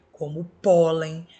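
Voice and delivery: pólen said slowly and loud; pólen slowly, leaning on pó; stressed example word. Shown as a demonstration. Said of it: "terminada em N".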